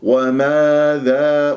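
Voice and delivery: slow وماذا with open alifs; drawn-out open-vowelled وماذا; relaxed tongue. Shown as a demonstration. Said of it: "اللسان مرتاح".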